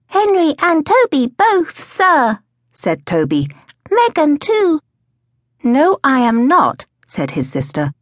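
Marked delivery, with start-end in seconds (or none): 0.1-2.4 put-on very high, slow child voice; drawn-out, high-pitched toddler voice; little boy Toby. A narrator's reading. 3.9-4.8 put-on very high child voice; high-pitched childish addition; little boy Toby. A narrator's reading. 5.6-6.8 high, slow contradiction; firmly refuse, drawing it out; girl correcting her little brother; sister's firm denial. A narrator's reading.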